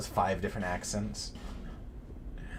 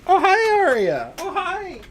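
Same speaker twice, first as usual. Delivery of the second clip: very high squealing greeting; 9 dB louder than usual. Real, not acted. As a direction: squeal hello at the pet. Baby talk to dog.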